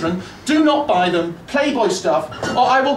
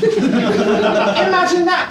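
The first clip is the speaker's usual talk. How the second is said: usual speaking voice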